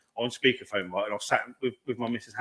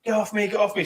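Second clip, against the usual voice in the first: mock scream; 8 semitones higher than usual. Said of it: "started screaming".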